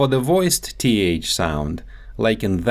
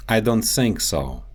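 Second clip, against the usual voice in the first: Russian accent, "think" said as "sink"; Russian accent, S in place of the TH in "think". Shown as a demonstration. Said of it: "The T H sound".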